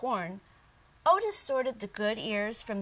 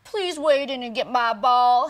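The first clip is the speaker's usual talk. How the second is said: much louder pleading plea; begging frog; 3 semitones higher, 11 dB louder than usual. Acted. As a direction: beg him desperately. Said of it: "he begged".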